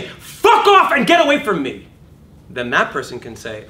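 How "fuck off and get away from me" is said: much higher and louder, slow attack; furious shout demo. Shown as a demonstration. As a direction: hurl it at your opponent. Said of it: "If I say".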